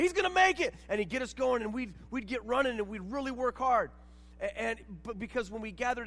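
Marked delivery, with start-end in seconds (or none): 0.0-0.7 high, loud cheering call; coach yelling. Retold or imitated. Assistant coach lapping runners in the hallway, yelling. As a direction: holler encouragement far above your usual pitch. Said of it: "he'd be yelling your name".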